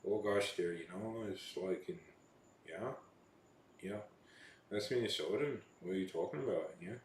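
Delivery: softly imitated Canadian chatter; Canadian accent. Shown as a demonstration. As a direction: lightly put on a Canadian accent. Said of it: "the trick to Canadian accent is always".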